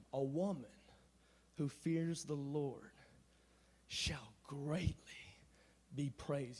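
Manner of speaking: slow, low and very soft; hushed scripture recital. Acted. Preacher quoting scripture to the ladies about women, hushed and slow. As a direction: let each phrase hang, nearly whispered.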